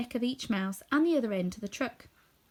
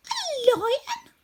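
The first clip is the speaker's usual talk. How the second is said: much higher, louder squeak of fright; alarmed cry; 6 dB louder than usual. A narrator's reading. Mouse queen hearing of the lion, frightened squeak. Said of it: "cried the little queen".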